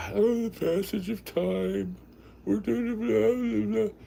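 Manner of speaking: high, slow mock woman's voice; mocking imitation of a condescending talker; mocking babbler. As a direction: draw it out in a high, babbling parody. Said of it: "You listen to her talk".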